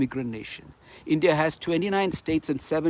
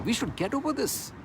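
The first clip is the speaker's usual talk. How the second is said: usual speaking voice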